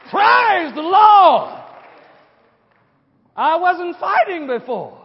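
a full-throated, slow, high cry of praise; shouted praise. Real, not acted. Preacher to congregation, a loud, slow shout of praise. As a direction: let it ring out to the rafters.

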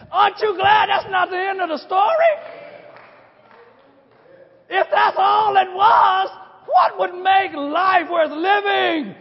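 loud, slow, hammering preacher shout; full-voiced pulpit cry; fiery preaching. Real, not acted. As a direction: drive the question home to the pews.

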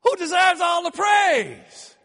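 shouted question. Acted.

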